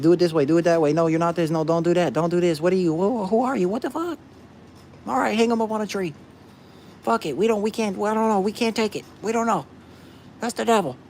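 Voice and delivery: high, loud mocking voice of the doubters; mocking the critics. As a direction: squeak out their nagging like a scolding crowd. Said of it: "That's how they think".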